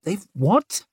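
high startled squeak. A narrator's reading. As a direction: squeak it out in shock. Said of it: "squeaked her husband".

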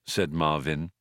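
usual speaking voice

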